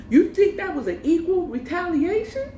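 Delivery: high-pitched indignant question; incredulous challenge. Retold or imitated. Woman challenges man over unequal retaliation, incredulous. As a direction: fling it back in disbelief.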